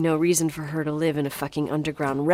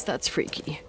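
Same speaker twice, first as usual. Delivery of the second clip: whisper; 2 semitones lower, 7 dB quieter than usual. Acted. Alone, unsettled discovery.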